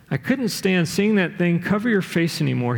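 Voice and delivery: usual speaking voice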